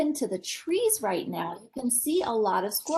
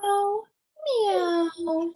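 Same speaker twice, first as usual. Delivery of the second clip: higher meowing like a cat; 7 semitones higher than usual. Acted. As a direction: meow sweetly for the children. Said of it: "said the cat".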